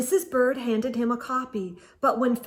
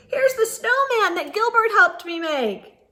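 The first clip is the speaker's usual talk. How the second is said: much higher, louder eager voice; excited child; 9 semitones higher, 6 dB louder than usual. Acted. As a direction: show it off excitedly. Louis excited about memory book.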